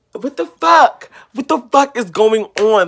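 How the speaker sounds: loud, high outburst; loud frustrated rant; exasperated shout. Real, not acted. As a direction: yell it at the world. Ranting about covid blame.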